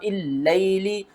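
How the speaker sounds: slow chant with long held vowels; correct recitation demo. Shown as a demonstration. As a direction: recite slowly, clean hamza after the pause. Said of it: "इसको पढ़ेंगे". (सही तरीके से धीमी तिलावत)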